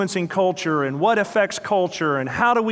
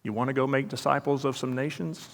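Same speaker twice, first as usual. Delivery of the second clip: quiet challenge; 3 semitones lower, 7 dB quieter than usual. Retold or imitated. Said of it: "he looks at me and says".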